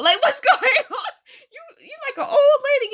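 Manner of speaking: high, loud, laughing tease; teasing disbelief. Retold or imitated. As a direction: imitate a friend ribbing you through laughter. Acted friend teasing her, laughing.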